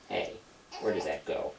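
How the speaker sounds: usual speaking voice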